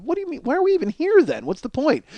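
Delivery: mock disbelief at his wife, voice jumping up; incredulous. Retold argument with wife at KFC.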